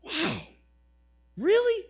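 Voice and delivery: mock astonishment at Jonah; preacher incredulous at Jonah's reaction. Real, not acted.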